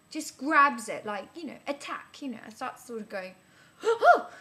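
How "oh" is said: loud, high startled gasp; startled jump. Retold or imitated. Guy grabs her arm, startled yelp. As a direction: yelp as if grabbed suddenly. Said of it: "I had a bit of a jump".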